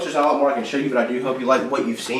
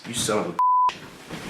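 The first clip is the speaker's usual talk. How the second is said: seething; 2 semitones lower, 6 dB quieter, much slower than usual. Acted. Homeowner finds the intruder.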